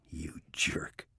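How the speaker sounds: playful whispered insult. A narrator's reading. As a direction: breathe it out teasingly. Teasing after mocking him.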